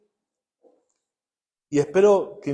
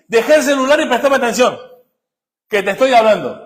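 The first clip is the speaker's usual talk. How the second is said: exasperated parent, higher and louder, half laughing; scolding parent; 6 semitones higher, 6 dB louder than usual. Retold or imitated. Parent scolding a child on the phone, loud and exasperated. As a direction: snap at the kid glued to the phone. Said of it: "y vos qué le decís".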